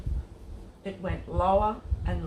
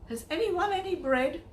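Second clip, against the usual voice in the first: calling out; 10 semitones higher than usual. A narrator's reading. Andrew calls through the crowd.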